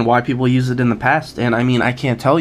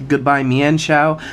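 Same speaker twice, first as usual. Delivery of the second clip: usual speaking voice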